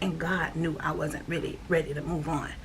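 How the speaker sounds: ordinary talk, nothing marked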